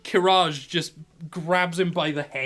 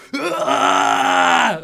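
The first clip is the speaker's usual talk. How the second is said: long, loud, high battle roar; intimidating roar; 10 semitones higher, 10 dB louder than usual. Acted. Big fighter roaring at the little guy, menacing. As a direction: roar full force to scare an opponent.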